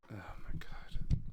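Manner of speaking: slow whispered disbelief; whispered aside. Acted. Loop reacting to Siffrin, slow whispered disbelief. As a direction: breathe it out in disbelief.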